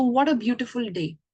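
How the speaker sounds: ordinary delivery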